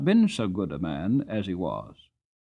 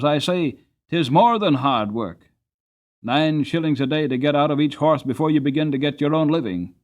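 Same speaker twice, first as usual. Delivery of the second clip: louder, pleading cabman near despair; almost desperate; 4 semitones higher, 6 dB louder than usual. A narrator's reading. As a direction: plead with the governor as if you have nothing left. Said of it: "in a voice that sounded almost desperate".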